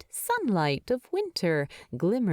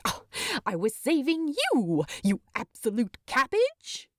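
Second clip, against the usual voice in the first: higher, quicker exasperated protest; indignant Bilbo; 5 semitones higher than usual. Acted. Bilbo snapping fondly at Thorin, higher and fast. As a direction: fire it back, half offended.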